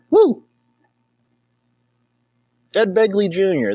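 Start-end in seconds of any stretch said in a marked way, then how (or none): 0.1-0.4 cheer. Real, not acted. Whoop after the yelling.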